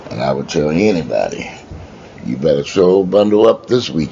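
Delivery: ordinary delivery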